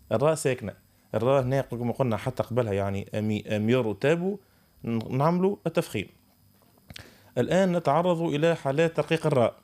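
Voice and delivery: ordinary talk, nothing marked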